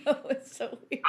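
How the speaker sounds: laughing through the words, quick. Real, not acted. Agreeing with friend about lizard pictures, laughing. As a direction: break up laughing while agreeing.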